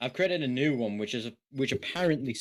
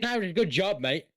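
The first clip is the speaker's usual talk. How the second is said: breezy Australian sniper, pitched higher; a mate's pat on the back; 7 semitones higher, faster than usual. Pretend. Friend tries a sniper voice, Australian-style. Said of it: "good sniper voice".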